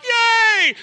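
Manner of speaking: high, loud shouted yay; crowd cheer. Retold or imitated. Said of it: "and you're all like".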